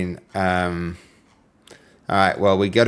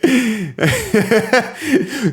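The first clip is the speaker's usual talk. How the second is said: high burst of laughter; 13 semitones higher than usual. Real, not acted. Reading a funny chat message, bursting into high laughter.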